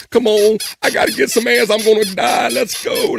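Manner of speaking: high, loud, frantic bug voice pleading for a mate; mock cicada mating call, high and urgent; desperate cicada voice. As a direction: squeal it like a desperate cicada in heat. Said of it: "They're like".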